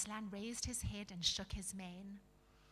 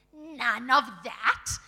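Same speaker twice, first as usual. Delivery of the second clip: slow, loud, outraged refusal; angry shout; 15 dB louder, much slower than usual. A narrator's reading. Dwarf warding off flowers at his nose, angry shout. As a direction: shout it crossly. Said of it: "he shouted".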